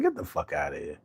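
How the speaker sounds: very soft, low disbelieving murmur; mock stunned hush. Telling a friend the dog actually came, stunned and soft. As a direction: breathe it out, floored. Retold amazement dog obeyed.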